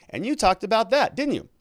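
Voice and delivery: high, mounting mock cross-examination; badgering lawyer. Acting lawyer piling up leading questions.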